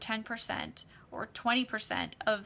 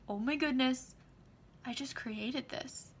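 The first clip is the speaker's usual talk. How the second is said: hushed awe; 2 semitones higher, 5 dB quieter than usual. Retold or imitated. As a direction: breathe it in wonder. Grateful awe at created money.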